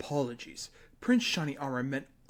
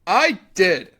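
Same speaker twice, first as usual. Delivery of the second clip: eager shout; 2 semitones higher, 11 dB louder than usual. Acted. Eager confirmation.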